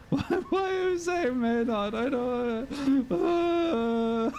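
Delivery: high-pitched, helpless laughter through the words; laughing. Real, not acted. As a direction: squeak it out while cracking up. Cracking up at Maynard question.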